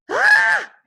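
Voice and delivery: high loud shriek of fright; mother screaming at a lion's roar. A narrator's reading. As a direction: let out a piercing scream. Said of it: "his mother screamed".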